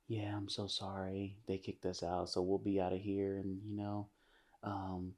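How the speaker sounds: hushed whisper so she can't hear; whispered apology, kept from her ears. Retold or imitated. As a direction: murmur it low and secret. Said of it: "He's whispering".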